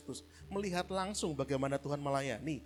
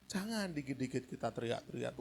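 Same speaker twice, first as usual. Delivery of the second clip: usual speaking voice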